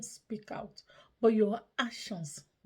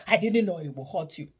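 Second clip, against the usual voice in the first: louder, harsh yelled apology; screamed; 9 dB louder than usual. Retold or imitated. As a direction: shout the apology angrily. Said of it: "if you have screamed".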